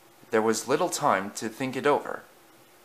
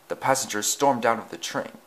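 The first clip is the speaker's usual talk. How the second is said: ordinary delivery, nothing marked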